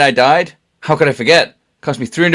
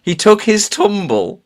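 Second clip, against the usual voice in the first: slow, savoring the words; 5 semitones higher, slower than usual. Retold or imitated. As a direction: draw it out slowly. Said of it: "the use of".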